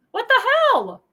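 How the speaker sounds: high, loud disbelieving yell; real angry what-the-hell; outraged. Real, not acted. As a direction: explode in disgust. Ranting vlogger's outraged exclamation.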